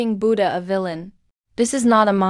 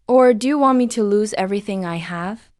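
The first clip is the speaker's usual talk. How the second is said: ordinary talk, nothing marked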